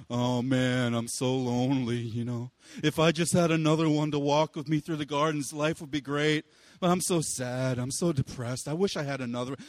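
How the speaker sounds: low, self-pitying moping voice; mopey emo Adam. Retold or imitated. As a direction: sulk and sigh like a lonely teen. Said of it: "like, emo Adam, he's just moping around".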